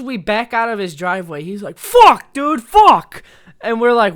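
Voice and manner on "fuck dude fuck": high, loud cursing outburst; pained yell. Retold or imitated. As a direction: yell it out as if just banged on the head. Imitates friend in trunk hitting bumps.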